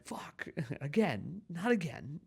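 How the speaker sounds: slow, drawn-out, high despair; exasperated groan. Retold or imitated. A dater finding the same deal-breaker, slow exasperated groan. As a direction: drag it out, fed up and laughing. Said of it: "and you're like".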